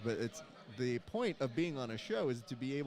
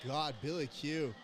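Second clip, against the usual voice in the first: normal talking voice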